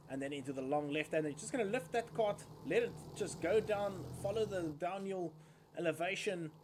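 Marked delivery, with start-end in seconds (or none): none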